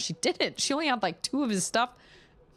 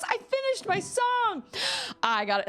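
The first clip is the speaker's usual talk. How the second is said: proud turtle. Retold or imitated. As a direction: crow about the finished song. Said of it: "and he goes".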